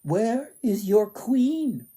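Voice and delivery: high, slow, haughty demand; demanding sisters. Acted. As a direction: drag out the question to the guard.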